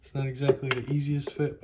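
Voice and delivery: ordinary delivery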